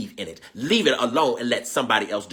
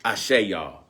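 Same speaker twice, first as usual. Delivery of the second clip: ordinary delivery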